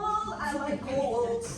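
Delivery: very slow, stretched-out words; slow drawn-out pleased repeat; savoring drawl. Pretend. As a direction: savor each word like a treat. Voicing the body enjoying cold.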